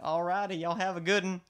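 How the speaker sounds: hammed-up Southern send-off; Southern accent. Signing off to drive-thru staff, cheery Southern accent. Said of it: "like, a really southern accent".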